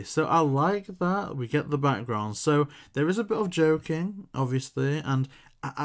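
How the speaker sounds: ordinary talk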